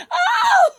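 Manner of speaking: high loud release yell; a short high shout, letting stress go; stress scream. Shown as a demonstration. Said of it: "Okay, do that with me".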